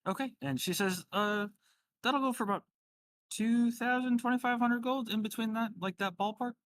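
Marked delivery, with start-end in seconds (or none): 1.1-6.5 higher-pitched shopkeeper voice; helpful shopkeeper. Acted. Shopkeeper quotes a customer a price, higher helpful voice. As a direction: pitch it up as an obliging shop lady.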